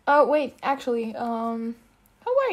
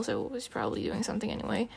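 fast whispered aside; 8 dB quieter, faster than usual. Acted. Telling her friend Stefan is busy, in a whisper. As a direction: drop to a whisper, quick.

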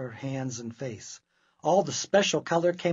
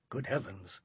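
ordinary delivery, nothing marked

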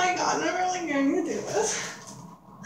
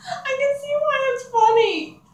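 fading helium squeak, much higher; 10 semitones higher than usual. Real, not acted. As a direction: say it as the helium wears off. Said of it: "I sound really high-pitched".

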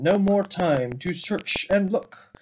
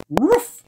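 dog bark imitated; 9 semitones higher, 6 dB louder than usual. The dog barks.

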